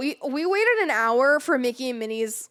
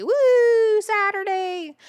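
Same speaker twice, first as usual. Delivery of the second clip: cheering; 8 semitones higher, 5 dB louder, much slower than usual. Real, not acted. Cheering the next day.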